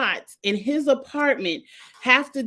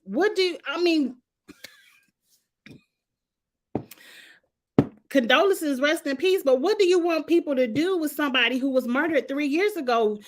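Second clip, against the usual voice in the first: exasperated retort; 6 semitones higher than usual. Retold or imitated. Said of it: "I'm like".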